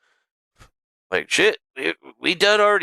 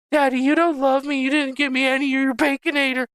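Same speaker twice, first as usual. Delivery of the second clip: much higher, whiny pet voice; squeaky dog voice; 10 semitones higher than usual. Pretend. Owner voicing his Chihuahua begging for the burger, squeaky high whine.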